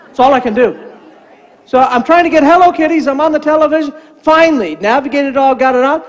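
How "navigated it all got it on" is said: usual speaking voice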